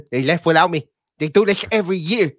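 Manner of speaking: high, odd put-on voice; imitated voice. Still mimicking the sequel character, high and put-on. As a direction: keep the silly high character voice going.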